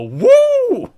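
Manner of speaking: very high, loud whoop; spontaneous commentator's cheer, imitated; Mike Tenay. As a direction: burst out with an imitated "woo". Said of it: "Mike Tenay to spontaneously just go".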